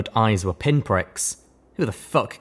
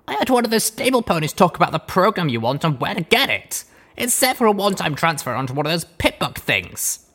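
Blackjack voice; 9 semitones higher than usual. A narrator's reading. Blackjack answering Deus.

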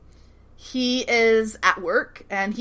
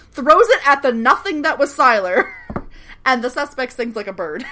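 ordinary delivery

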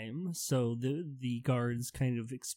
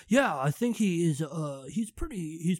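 dopey, drawling student voice; dumb college student; 5 semitones higher than usual. Acted. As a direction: play a clueless college kid. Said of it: "talk to you like kind of a dumb college student".

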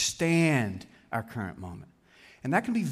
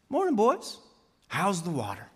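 lightly voiced older fish, pitch dropping on the question. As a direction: greet the youngsters as an older fish in passing. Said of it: "they happen to meet an older fish".

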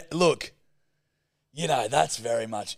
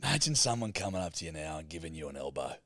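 normal talking voice

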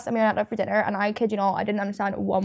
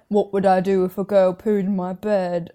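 lightly voiced TikTok guy, lower pitch; 4 semitones lower than usual. TikTok guy replying to on-screen comments, lightly imitated.

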